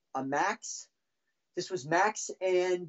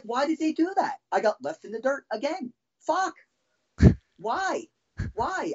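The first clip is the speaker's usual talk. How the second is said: high-pitched, aggrieved questioning; wounded frustration; 7 semitones higher than usual. Real, not acted. Drummer left in the dirt again, wounded frustration. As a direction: protest like someone abandoned once more.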